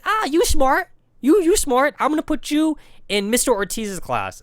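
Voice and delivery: put-on high, loud accented praise; imitating the history teacher. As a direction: gush like an insistent teacher. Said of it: "she's like".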